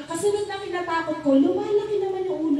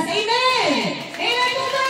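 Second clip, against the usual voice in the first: calling for amen; 6 semitones higher than usual. Real, not acted. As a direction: rouse the congregation to answer. Preacher calls congregation for amen. (masiglang tawag ng amen)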